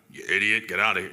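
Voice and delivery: a stern rebuke, only mildly acted; mock harsh scolding. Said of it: "coming down with the hammer and be like".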